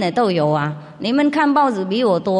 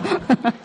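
high short laugh; 6 semitones higher than usual. Real, not acted. Laughs at own joke.

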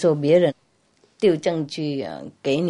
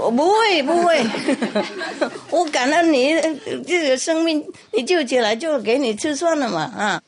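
mouse promising; 9 semitones higher than usual. Acted. Mouse assures the cat it won't run, high squeak. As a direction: protest loyalty with laughter.

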